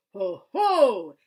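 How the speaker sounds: a monster gloating over its catch; Heffalump's Ho ho. Acted. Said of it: "in the sort of voice that Heffalump".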